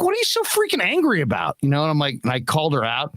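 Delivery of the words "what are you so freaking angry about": high, pitched-up indignant question; exasperated mock shout. Father confronting angry hairdresser, high indignant challenge. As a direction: throw it back at her, incredulous.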